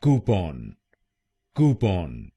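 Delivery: Coupon with कू stressed; coupon, KOO-pon, weight on कू; stress on first syllable. Shown as a demonstration. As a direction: punch कू in coupon. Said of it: "पहले शब्दांश पर ... जोर डालना है".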